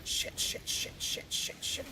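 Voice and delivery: hushed curses under breath; whispered swearing. Real, not acted. Swearing while cuss-banned.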